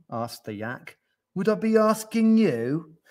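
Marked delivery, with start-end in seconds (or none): 1.3-3.0 high, loud, exasperated question; indignant retort. A narrator's reading. Yak snaps back at gnu, indignant.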